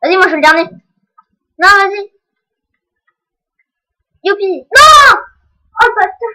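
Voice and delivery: loud, high rage yelling; raging gamer. Real, not acted. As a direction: lose it at the game. Losing the final duel.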